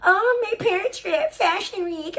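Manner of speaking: high-pitched mocking brag; exaggerated high boastful impression; Beta. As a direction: mimic a show-off in a silly put-on voice. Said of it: "I heard Beta bragging".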